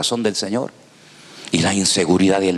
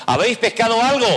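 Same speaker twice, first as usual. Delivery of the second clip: calling out; 14 semitones higher than usual. Acted. Jesus calls to the fishermen.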